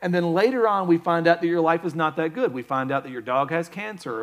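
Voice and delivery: normal talking voice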